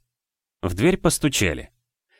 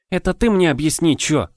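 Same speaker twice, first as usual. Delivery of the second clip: mild mocking mimic; 8 semitones higher, 3 dB louder than usual. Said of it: "передразнила девочка".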